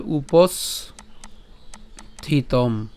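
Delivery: উপস্থিতম stretched very slowly, each syllable held; drawn-out pronunciation. Shown as a demonstration. Teacher demonstrating উপস্থিতম very slowly. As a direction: pull উপস্থিতম out long and slow. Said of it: "তাহলে কি হবে?".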